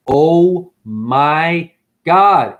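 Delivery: very slow, loud, each word stretched; emphatic, long-held exclamation; drawn-out exasperation. Real, not acted. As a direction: stretch out every word in disbelief.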